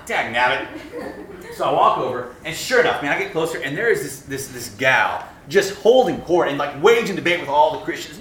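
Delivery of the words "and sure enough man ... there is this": normal talking voice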